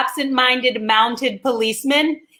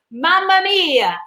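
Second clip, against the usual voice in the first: mock Italian exclamation; 6 semitones higher, slower than usual. Italian wife's exclamation in joke.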